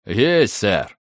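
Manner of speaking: low booming soldier's answer; boomed reply. A narrator's reading. Constable booming to his commissioner, lightly voiced. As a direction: boom it like a big constable. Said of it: "прогудел Двайер".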